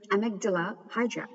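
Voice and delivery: usual speaking voice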